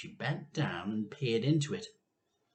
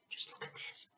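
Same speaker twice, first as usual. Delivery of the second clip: whispered aside; 12 dB quieter, faster than usual. Real, not acted. Reader whispers to viewers, showing the picture. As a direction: whisper it to the audience. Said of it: "aside to viewers".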